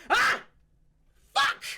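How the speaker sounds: loud, high yelp of pain. Acted. Elf bursts into flames, loud pained yelp. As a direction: cry out as the fire burns.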